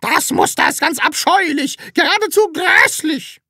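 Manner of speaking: very high, loud, emphatic yell; piercingly high landlady's shout; shrieking. A narrator's reading. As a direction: shout each damning word. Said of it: "schrie sie".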